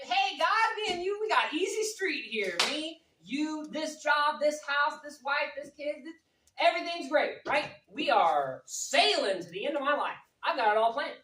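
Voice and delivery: high, loud, cocky bragging to God; a cocky man bragging to God; smug boast. Retold or imitated. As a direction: crow about having it all.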